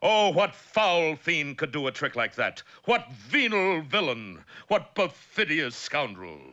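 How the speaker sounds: slow, declaimed indignation; hammy narrator aghast at villainy; melodramatic outrage. Acted. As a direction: denounce the villain like old melodrama.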